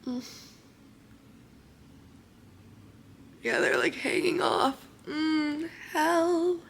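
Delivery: soft, half-whispered talk through loose temporary teeth; keeping the voice down, mouth sore after surgery; hushed, barely talking. Real, not acted. Teeth falling out, can barely talk.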